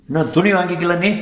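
high-pitched official's query; questioning the newcomer. A narrator's reading. Man at headcount asks new prisoner about clothes, high questioning voice.

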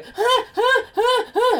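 high loud hammed-up panting; mock panting. Mocking the partner mid-act with loud panting. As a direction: pant like he's at it.

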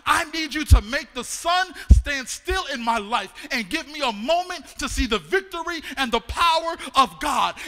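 loud pleading prayer; preacher shouting a bold prayer; fervent prayer. Acted. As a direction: cry out to God with force.

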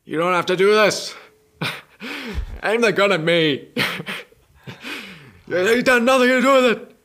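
pleading police commissioner; Commissioner Gordon impression. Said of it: "Everyone do an impression of one of the characters".

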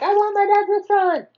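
high, rapid mock squeal; eager squeal. Tiana tells Naveen what she wants, high eager squeal. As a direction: blurt it out fast and very high.

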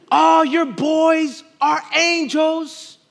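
high, slow and sugary; gushing admirer. Retold or imitated. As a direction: coo it like a doting church lady. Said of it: "receive comments like this".

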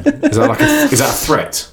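high laughing mock alarm. Real, not acted. Joking to cohost about wife's dark question, laughing.

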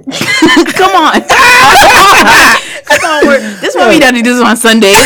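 high loud laughing call; laughing shout. Real, not acted. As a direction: cackle it out, half shouting. Church joke, laughing shout.

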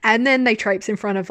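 ordinary talk, nothing marked

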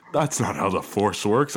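Harrison Ford line. Retold or imitated. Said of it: "I felt like Harrison Ford".

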